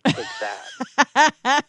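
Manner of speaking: slow, high, loud teasing through laughter; laughing taunt. Real, not acted. Teasing JT after the crisscross applesauce, laughing gloat. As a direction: gloat playfully while laughing.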